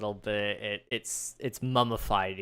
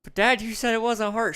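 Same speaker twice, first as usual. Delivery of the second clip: put-on very high, loud whiny boy; kid protesting; 12 semitones higher, 6 dB louder than usual. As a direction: protest like a wronged child.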